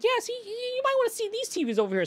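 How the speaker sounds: high, sugary sales pitch; fake salesman voice. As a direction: steer the customer away sweetly. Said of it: "I was like".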